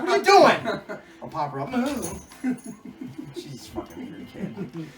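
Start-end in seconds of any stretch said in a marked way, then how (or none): 0.0-1.0 high, loud baffled cry; mock exasperated yell. Dad to his weird toddler, mock exasperated shout. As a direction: yell it in comic disbelief. Acts parent scolding odd child.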